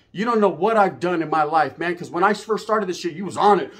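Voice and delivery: ordinary talk, nothing marked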